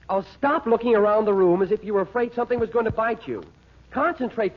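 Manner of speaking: exasperated scolding. Acted. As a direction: snap at her to stop fidgeting and focus. Husband scolding nervous wife.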